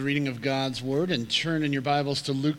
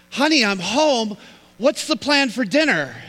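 raised, pitched-up voice straight into her ear; mock shouting into his wife's ear; husband leans in behind his wife, speaking loudly into her ear; loud right in her ear; 5 semitones higher, 5 dB louder than usual.

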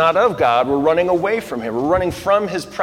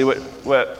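ordinary talk, nothing marked

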